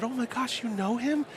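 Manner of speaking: put-on high, starstruck kid voice; excited child. Child recognizing a celebrity, retold.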